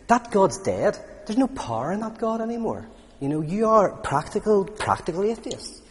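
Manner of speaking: Nietzsche's mocking challenge. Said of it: "And he says".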